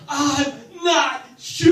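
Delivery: high, loud, slow drawn-out yell; mock climax shout. Agnostic comic at climax, loud drawn-out yell.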